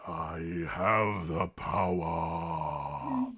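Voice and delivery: slow, low villain declaration; epic villain voice. Acted. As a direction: proclaim it darkly, very slow. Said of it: "Y'all have such epic voices".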